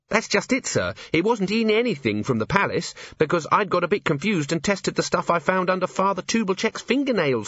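high-pitched, eager report to a superior; earnest junior officer. Acted.

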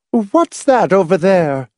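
high, slow irritable question; irritable demand. A narrator's reading. Mother pointing at the building, irritable demand. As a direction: demand an answer testily. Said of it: "Missus Pritchett demanded irritably".

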